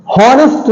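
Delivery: हॉनेस्ट with the h sounded; wrong pronunciation. Shown as a demonstration. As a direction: breathe an h at the start of हॉनेस्ट. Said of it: "नहीं होता है".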